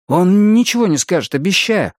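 high, hurried promise; hasty reply. A narrator's reading. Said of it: "поспешно ответил Арни". (поспешное обещание высоким голосом)

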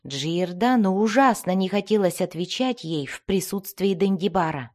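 plain reading voice

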